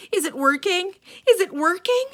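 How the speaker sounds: high, loud calling through a door; yelled. Acted. Mother yelling through the bathroom door, loud. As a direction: call out urgently. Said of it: "stood outside the door and yelled".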